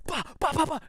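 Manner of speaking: high, loud comic bleats; silly yelping bahs; mock angel cries. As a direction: squawk them out as tossed angels. Angels thrown onto pile.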